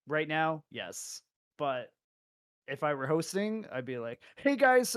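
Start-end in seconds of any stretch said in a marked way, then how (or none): 4.4-4.9 much higher, louder hyped host; YouTube intro voice. Pretend. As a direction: greet viewers like a hyped streamer. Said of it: "like, a YouTube intro".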